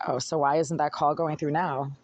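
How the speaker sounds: ordinary talk, nothing marked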